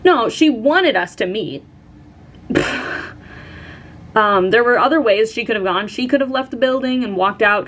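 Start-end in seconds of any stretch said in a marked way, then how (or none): 2.5-3.1 long puffed-out breath; exasperated sigh. Real, not acted. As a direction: blow out air in exasperation. Exasperated at being made to meet.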